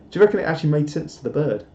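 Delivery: normal talking voice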